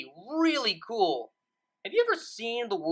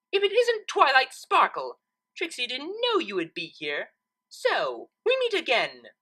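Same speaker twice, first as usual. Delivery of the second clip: much higher, theatrical boastful mare; smug Trixie; 7 semitones higher than usual. Acted. Trixie greeting Twilight at the window, smug and theatrical. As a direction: gloat like a stage magician greeting her rival.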